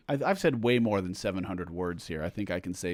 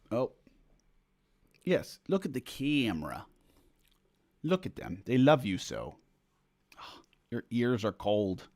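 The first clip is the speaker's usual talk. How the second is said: cooing to cat; 3 dB quieter than usual. Real, not acted. Talking softly to her cat.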